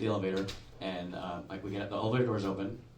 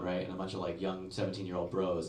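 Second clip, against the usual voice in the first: usual speaking voice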